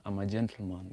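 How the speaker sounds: normal talking voice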